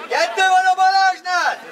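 real high, carrying pitch shout; shouted warning. Real, not acted. Player warning teammates about Balázs, shouted warning. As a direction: call it out across the pitch.

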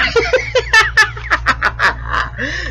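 long, high-pitched cackling laugh; real laughter. Real, not acted. Host after telling his punchline, real laughter. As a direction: let a high laugh run on at your own joke.

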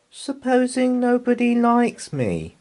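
put-on high, small child's voice; little girl Anna. A narrator's reading. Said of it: "said Anna".

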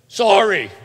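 put-on loud, high, exasperated kid's sorry; insincere shouted apology. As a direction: blurt out a sorry you don't mean. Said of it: "That's not meaning it".

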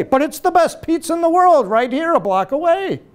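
put-on high, loud, insistent old-man voice; stubborn old father. Old father telling his son the corner pizza is best, insistent. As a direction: plant your feet, nothing beats the corner shop.

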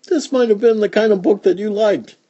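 singsong and high; high-pitched aside. Real, not acted. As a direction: lift the whole line way up. Playful closing aside, very high.

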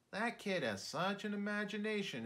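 a hint of an admiring teacher; lightly voiced teacher.

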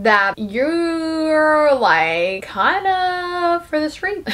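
slow, swooping hesitant delivery; drawn-out teasing. Retold or imitated. Will teasing Ado Annie about gossip, drawn out. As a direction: stretch each word out slowly.

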